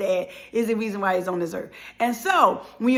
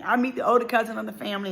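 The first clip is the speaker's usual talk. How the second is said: ordinary talk, nothing marked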